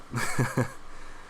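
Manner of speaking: breathy laugh. Real, not acted. Breathless laugh after the rant.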